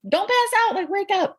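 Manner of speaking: high, urgent call to stay awake; urgent nurse. Retold or imitated. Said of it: "was like".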